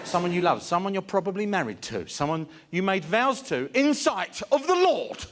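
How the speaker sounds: mock indignation. Lecturing the fearless about married vows, mock-righteous crescendo. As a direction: hammer each phrase harder, climbing in pitch.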